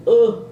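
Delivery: a loud, annoyed "ugh"; frustrated groan. Retold or imitated. Wandering the gym to stall, groaning in frustration. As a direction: groan at yourself.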